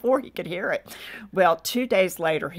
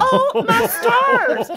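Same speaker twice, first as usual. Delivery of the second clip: very high, loud, long-drawn cry; hammed-up horror, stretched right out; mortified exclamation; 8 dB louder, much slower than usual. Wife hearing her message played to callers, mortified.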